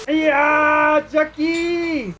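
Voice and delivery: high, drawn-out call. Acted. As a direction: call out across the garage. Calling out to Jackie.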